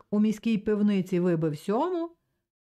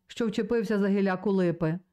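ordinary delivery, nothing marked

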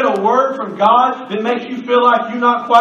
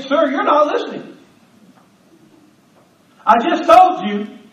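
ordinary talk, nothing marked

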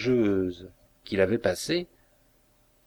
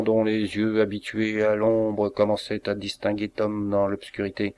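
ordinary delivery

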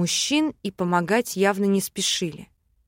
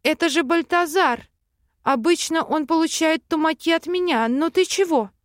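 pleading; 8 semitones higher, 3 dB louder than usual. A narrator's reading. Wife stops husband attacking.

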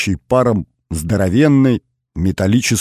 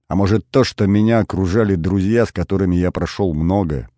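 plain reading voice

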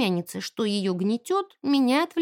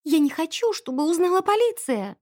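high-pitched worried plea; near tears; 6 semitones higher than usual. A narrator's reading. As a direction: plead as if close to crying. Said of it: "она чуть не плакала". (жалобно, чуть не плача)

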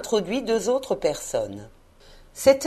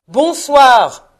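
loud, higher, falling goodnight; exasperated dismissal; 6 semitones higher, 11 dB louder than usual. A narrator's reading. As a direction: drive him out with the word. Scrooge répète son congé. (congédiement exaspéré et fort)

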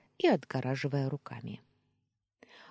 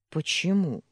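taken aback; 4 semitones higher, 7 dB louder than usual. A narrator's reading. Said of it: "опешила я".

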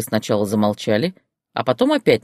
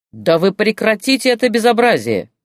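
higher, louder bellow to stop the chaos; barked shout; 9 semitones higher, 5 dB louder than usual. Acted. Boss bellows at the brawling women to stop, loud. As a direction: roar it over the noise. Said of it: "снова рявкнул шеф".

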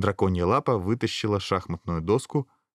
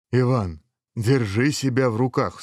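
firm, higher-pitched reprimand; stern; 4 semitones higher, 3 dB louder than usual. A narrator's reading. Dragon head Pyotr scolding head Ivan, stern. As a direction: tell him sternly to behave. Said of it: "строго сказала голова Пётр".

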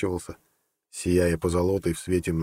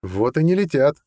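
sly, raised-pitch contradiction; 8 semitones higher, 4 dB louder than usual. A narrator's reading. Judge answers the angry general, slyly amused. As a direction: contradict him with a crafty smirk in the voice. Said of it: "хитро усмехнулся судья".

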